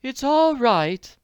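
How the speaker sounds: high, loud, very slow announcing; calm the diners from the door; reassuring manager. Acted. Manager informs diners, slow announcing voice. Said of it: "she informed the diners".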